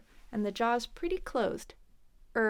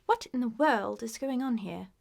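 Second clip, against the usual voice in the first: mock British, r-less "world" and "here"; British accent. Shown as a demonstration. Teacher shows going too far forward, British accent. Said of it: "you sound more British".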